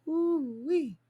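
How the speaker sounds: high, delighted ooh-wee; excited whoop. Real, not acted. Excited about new bodies.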